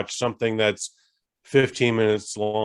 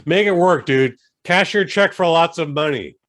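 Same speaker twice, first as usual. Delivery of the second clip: mock scolding; 6 semitones higher, 6 dB louder than usual. Scolding Craig, louder.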